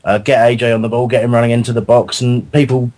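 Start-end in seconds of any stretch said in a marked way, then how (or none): none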